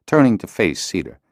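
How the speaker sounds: ordinary delivery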